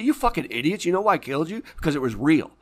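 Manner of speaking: loud, high, scornful telling-off; furious shout; angry retort. Retold or imitated. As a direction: yell it at the fools who missed the point. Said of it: "I say".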